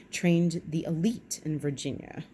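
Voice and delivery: ordinary delivery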